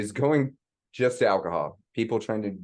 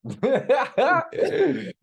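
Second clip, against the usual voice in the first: high, delighted laugh; 3 dB louder than usual. Real, not acted. Amused by the breathing joke.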